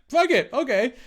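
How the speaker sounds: high, loud, devil-may-care agreement; reckless yes. Retold or imitated. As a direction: throw caution away, loud and high. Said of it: "I'm like".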